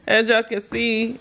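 ordinary delivery

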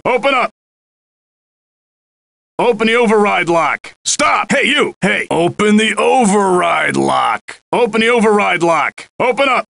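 barked orders through a locked door; shouting cop. Acted. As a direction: pound on the door and yell.

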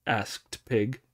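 ordinary delivery

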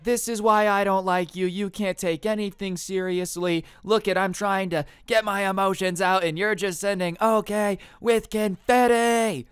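high, loud mock screaming tirade; over-the-top angry yelling. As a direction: imitate an outraged stepmom shrieking, dragging out the last words. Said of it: "she starts screaming".

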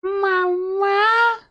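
put-on high squeaky baby voice. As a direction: imitate a small child calling mama. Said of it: "That's like a baby voice".

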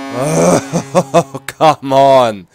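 loud, drawn-out groan of protest; exasperated. Real, not acted. Player protests another goal against, exasperated.

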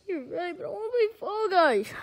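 high, loud pleading whine; protesting whine. Pretend. Tyrone begs to keep playing, whining protest. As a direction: whine like a kid refusing to go.

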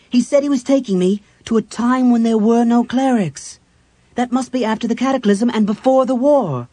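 high, loud, urgent reasoning; agitated realization. A narrator's reading. Cleric works out when they have landed, agitated and loud. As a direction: think aloud in rising alarm.

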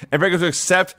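ordinary talk, nothing marked

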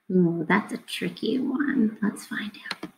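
suspenseful whisper. Acted. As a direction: keep them guessing in a hush. Teacher building suspense for children.

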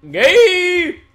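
high, loud drawn-out yell; mock shout. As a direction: belt the word out high and long. Sudden mocking shout.

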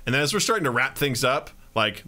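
normal talking voice

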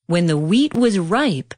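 ordinary delivery, nothing marked

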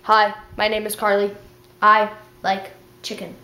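low, even robot monotone. Pretend. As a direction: separate the words like a machine. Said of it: "talk in our robot voices".

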